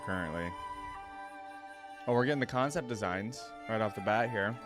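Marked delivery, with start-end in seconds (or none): none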